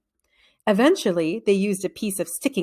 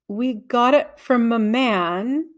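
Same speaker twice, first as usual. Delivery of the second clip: slow, careful answer, every word drawn out; slowly; 4 semitones higher, much slower than usual. Acted. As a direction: hold each word back, very slow. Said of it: "said Jeff slowly".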